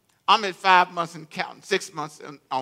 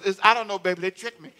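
ordinary talk, nothing marked

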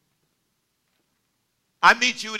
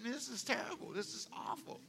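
muttered complaint; 4 semitones higher, 15 dB quieter than usual. Retold or imitated. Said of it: "I'm saying".

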